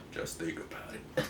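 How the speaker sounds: a breathy aside, barely voiced; whispered repeat. Real, not acted. Telling the viewer about Stan's comic logic, in a whisper. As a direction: drop it to a whisper.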